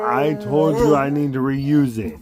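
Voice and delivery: normal talking voice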